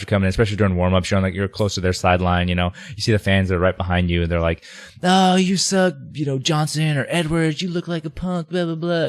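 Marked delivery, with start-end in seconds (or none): 5.0-9.1 high taunting fan voice; mock heckler yell. Heckling fan taunts a linebacker in warm-ups. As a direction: jeer at the player like a rowdy fan. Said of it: "they're screaming at you".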